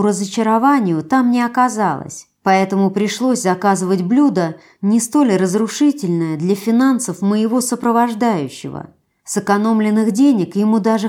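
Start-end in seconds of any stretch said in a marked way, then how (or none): none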